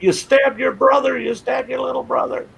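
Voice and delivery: high-pitched mock accusing yell; yelling cops. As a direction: accuse him like shocked, hollering cops. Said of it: "they're yelling at him".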